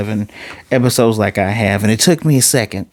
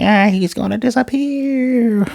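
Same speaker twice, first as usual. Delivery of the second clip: slow, high gloating taunt; drawn-out mocking sing-out, pitched way up; gloating villain; 12 semitones higher, slower than usual. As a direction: imitate a villain half-laughing in triumph. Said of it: "he's kinda laughing like".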